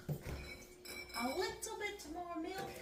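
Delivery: high, slow and soft, lilting; high soft sing-song. Pretend.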